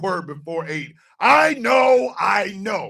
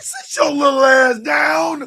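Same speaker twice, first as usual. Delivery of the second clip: mock yell; 8 semitones higher, 8 dB louder than usual. Yelling at short man.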